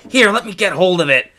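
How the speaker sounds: put-on high, loud boyish order; urgent command. A narrator's reading. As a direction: take charge in a hurry. Boy helps, bear coming.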